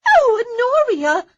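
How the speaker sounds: high, loud shriek of alarm. A narrator's reading. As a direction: shriek her name in shock. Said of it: "they heard Daisy shriek".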